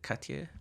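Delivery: Katya tried softly as a question, English-style T; tentative pronunciation attempt. Shown as a demonstration. Asking a friend how to say it, Katya attempted tentatively. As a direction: try out Katya, unsure of the soft T. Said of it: "how do you, how is it?".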